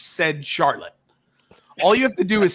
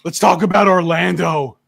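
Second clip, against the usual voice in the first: loud, raised voice making fun of a co-host; mocking Mikey; 4 semitones higher, 6 dB louder than usual. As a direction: do a teasing, hammed-up impression of your buddy. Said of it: "making fun of Mikey voice".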